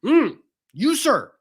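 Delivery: high, theatrical "you, sir"; mock scolding. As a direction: wag a finger in hammed-up outrage. Mock indignation.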